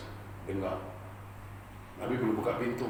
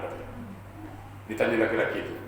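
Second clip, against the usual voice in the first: ordinary delivery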